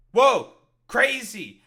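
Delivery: loud, slow, high fake wonder; mock amazement. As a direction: ham up the awe sarcastically.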